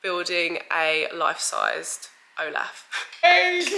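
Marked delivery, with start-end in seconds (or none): 3.2-3.8 gushing mummy voice, stretched out slowly. Real, not acted. Mum greets toddler after nap.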